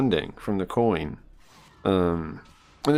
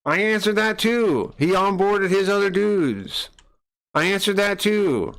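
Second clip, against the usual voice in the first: insistent and higher; exasperated; 7 semitones higher than usual. Real, not acted. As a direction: keep insisting, fed up. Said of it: "Oh, I'm so annoyed with that".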